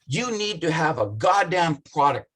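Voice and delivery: normal talking voice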